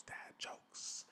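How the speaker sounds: very faint distant whispered echo; fading whispered echo. Shown as a demonstration. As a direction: let the echo die away in a whisper. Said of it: "That's how I'm gonna do the segment".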